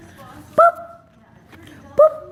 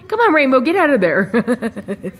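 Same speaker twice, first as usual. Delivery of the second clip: ordinary delivery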